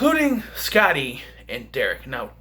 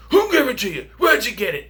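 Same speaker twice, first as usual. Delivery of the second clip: higher, louder demanding questions; angry confronting; 9 semitones higher, 4 dB louder than usual. Retold or imitated. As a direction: demand answers like a furious brother. Brother confronted about cocaine.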